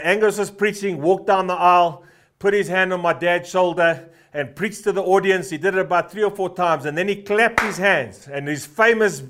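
ordinary talk

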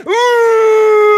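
very high, loud imitated birthing wail; labour cry. Preacher mimics labour cry.

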